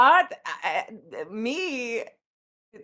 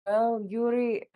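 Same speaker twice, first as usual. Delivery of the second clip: stalling. Acted. Goat mother dodging the question.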